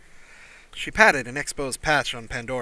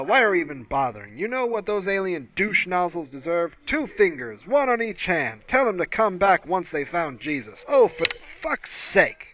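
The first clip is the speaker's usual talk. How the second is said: loud, brash radio host, getting angrier; ranting DJ fed up with the news; louder, higher angry talk-radio rant; radio shock jock; 7 semitones higher, 6 dB louder than usual. Acted.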